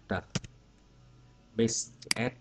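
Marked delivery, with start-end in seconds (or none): 0.1-0.2 ED ending read as "tờ"; "tờ" sound. Shown as a demonstration. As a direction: say "tờ" as a short t sound. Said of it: "phát âm nó là đọc là".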